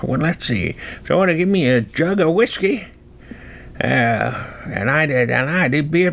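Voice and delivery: put-on high-pitched rustic old-timer voice; folksy old-timer. Old-timer tempted by a gift jet, folksy and high. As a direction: rattle it off like a tickled backwoods codger.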